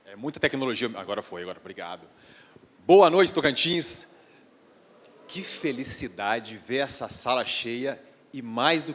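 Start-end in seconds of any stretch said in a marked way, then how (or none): 2.9-4.1 big slow shout-out to the hall; loud greeting to crowd. Real, not acted. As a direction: call out to the whole audience.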